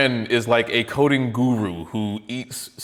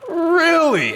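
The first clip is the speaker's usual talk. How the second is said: even higher, stunned really; more surprised; 5 dB louder than usual. Real, not acted. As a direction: push the disbelief further up. Repeats in disbelief.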